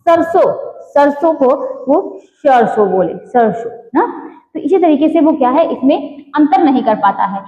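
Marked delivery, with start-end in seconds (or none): 0.1-4.1 सरसों said with sh, like sharson; mispronounced sarson. Shown as a demonstration. Teacher mimicking a child who can't say s. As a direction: swap the s for sh in सरसों. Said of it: "शॉल को शाल बोले".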